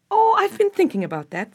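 high, bright with a laugh in it. A narrator's reading. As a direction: laugh it out, delighted with the idea.

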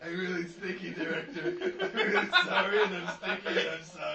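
laughing, sheepish apology to the director; sheepish actor apology. Pretend. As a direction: play the guilty actor, giggling.

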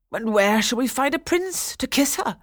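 high, loud questioning vizier; querulous vizier. A narrator's reading. Vizier asks Lilac about the prince, querulous. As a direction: ask doubtfully, climbing in pitch.

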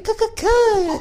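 very high squeaky cuckoo. Retold or imitated. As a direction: squeak it like a cartoon. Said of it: "someone saying".

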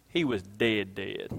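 low, near-silent "dead, dead"; hushed emphasis. Shown as a demonstration. Preacher demos emphasis with a wasp, very hushed. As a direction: let the voice sink on the repeat. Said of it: "You know how dead he was?".

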